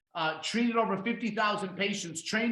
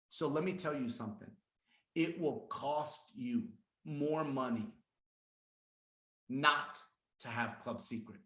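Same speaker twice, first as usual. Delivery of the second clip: "It will cost you", then "more money", a long pause, then "not to have Club Secret"; dramatic pauses; 5 semitones lower, 6 dB quieter than usual. Shown as a demonstration. Sales presenter showing when to pause, dramatic pauses. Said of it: "You have to learn when to pause".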